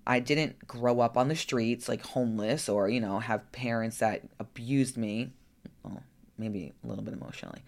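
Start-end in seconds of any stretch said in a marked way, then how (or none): 5.8-7.6 much softer, fast muttered aside; throwaway aside. Real, not acted. Sly aside to viewers about her parents, softer and quick. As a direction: toss it off under your breath.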